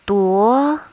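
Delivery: Duo gliding upward; second tone. Shown as a demonstration. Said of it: "this is how we mark the tones".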